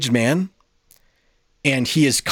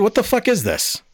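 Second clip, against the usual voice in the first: incredulous outburst; 10 semitones higher than usual. Retold or imitated. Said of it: "And I went".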